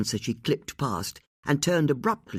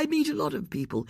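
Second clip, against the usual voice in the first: rushed; 10 semitones higher, faster than usual. A narrator's reading. Flustered hurried excuse.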